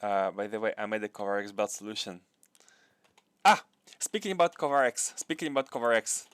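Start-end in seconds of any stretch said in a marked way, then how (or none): 3.4-6.3 louder, higher burst of interest; suddenly eager to share; excited about his idea. Real, not acted. Excited aside to chat.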